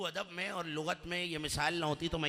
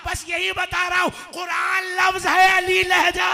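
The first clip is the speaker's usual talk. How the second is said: high, sustained shout, last words drawn out; full-force preaching climax; 14 semitones higher, 14 dB louder than usual. Real, not acted. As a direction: proclaim it at the top of your voice. (पूरे जोश में बुलंद नारा)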